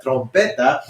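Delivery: Trompeta with a Spanish r, stressed on "pe"; Spanish pronunciation. Shown as a demonstration. Teacher invites class to repeat, Spanish pronunciation. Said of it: "Why don't you try to say that?".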